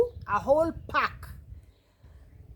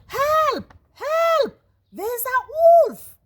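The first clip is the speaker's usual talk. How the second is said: high, loud drawn-out yell; screamed cry for help; 9 dB louder, slower than usual. A narrator's reading. Boy tricking the villagers with a wolf alarm, screaming. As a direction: shout it out like a false alarm. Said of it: "shouting at the top of his voice".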